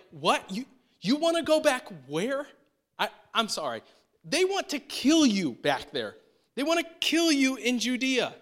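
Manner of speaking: high, loud incredulous protest; as if he's lost his mind, push back; alarmed disbelief. Retold or imitated. Disciples protesting to Jesus, high alarmed disbelief.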